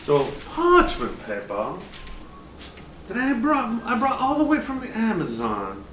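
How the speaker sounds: slow, lightly Southern cooking-show voice; hint of a Southern chef bragging; Southern drawl. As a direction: spin a tall tale in a mild Southern drawl. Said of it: "southern drawl".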